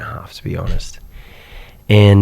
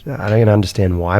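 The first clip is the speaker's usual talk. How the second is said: ordinary talk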